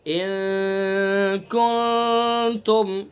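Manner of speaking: इन कुंतुम drawn out slowly, the n hummed through the nose; nasal ikhfa. Shown as a demonstration. Teacher demonstrating ikhfa to students, slow nasal recitation. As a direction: stretch इन कुंतुम with a long nasal ghunna. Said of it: "देखिए इन नाक के बासे से अदा करना".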